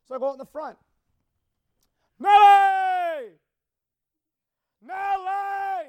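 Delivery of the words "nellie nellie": high, drawn-out holler; yelling for the dog. Shown as a demonstration. Owner calls the lost dog out front, high drawn-out yell. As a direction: call the dog's name long and loud. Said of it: "and I'm yelling".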